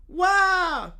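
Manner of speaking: high, loud fake baby wail; hammed-up toddler wah; mock wail. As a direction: cry out like a bratty child. Caillou cries.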